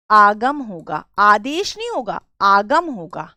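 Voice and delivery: आगम hit loud and falling; stress on आगम. Shown as a demonstration. Teacher stressing आगम against Adesh to students. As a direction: punch आगम, then नहीं. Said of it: "stressing आगम vs आदेश".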